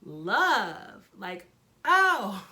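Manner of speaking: loud, drawn-out scoff at the word; mock outrage, punched out; mocking disbelief. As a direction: throw it back in loud, exaggerated disbelief. Scoffing at the rival's excuse.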